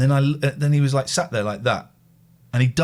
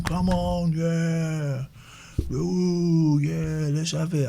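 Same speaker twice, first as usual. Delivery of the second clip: higher, drawn-out mock cheer; 5 semitones higher, much slower than usual. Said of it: "Cheering them on".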